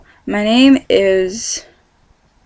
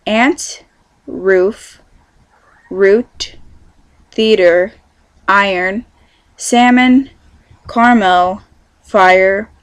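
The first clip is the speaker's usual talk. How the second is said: word-list pronunciation; 5 dB louder, much slower than usual. Shown as a demonstration. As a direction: read out word by word, each one set apart. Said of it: "I have to pronounce the following words".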